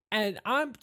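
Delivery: high-pitched, swooping presenter voice; host the show with big, high energy; perky show host Savannah. Acted. [name] introduces Burger Boys Interdimensional on camera, perky host.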